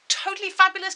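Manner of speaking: bright, loud, high burst of praise; excited praise. Real, not acted. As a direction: cheer them on. Praising pupils who got all answers.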